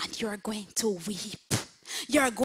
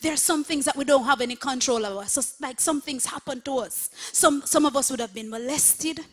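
louder preaching, pressing each point home; 3 semitones higher, 6 dB louder, faster than usual. Acted. Pastor naming what congregation suffered, earnest louder preaching.

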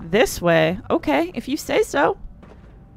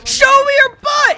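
shout; 13 semitones higher, 4 dB louder than usual. Acted. Taunting enemy, then yelled.

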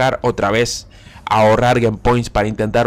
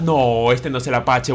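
normal talking voice

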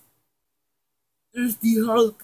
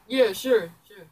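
ordinary talk

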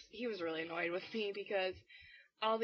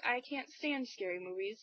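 usual speaking voice

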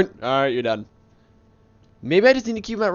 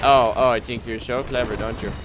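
usual speaking voice

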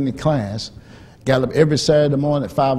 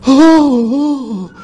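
imitated dismay; 12 semitones higher, 8 dB louder than usual. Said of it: "started crying".

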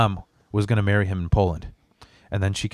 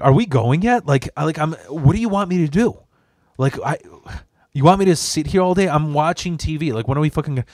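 higher-pitched impatient complaint; exasperated; 6 semitones higher, 4 dB louder than usual. Retold or imitated. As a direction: demand answers, fed up with waiting. Acted impatient complaint to mom.

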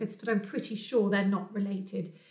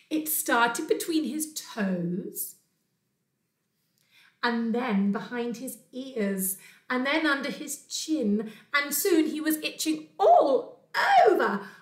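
story narration; 2 semitones higher, 4 dB louder, slower than usual. A narrator's reading. Teacher reads of Bear's spreading itch, building storytelling. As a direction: let the itch spread, stretching the key words. Reads picture book to children.

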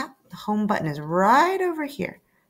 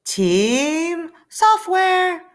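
very slow, higher drawn-out name; grand announcement; 7 semitones higher, 5 dB louder, much slower than usual. Acted. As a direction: announce the team like a title.

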